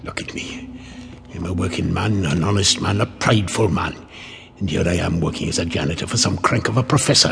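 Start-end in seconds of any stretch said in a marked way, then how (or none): 1.3-6.1 self-pitying grumble. Acted. Grumbling to himself about his job.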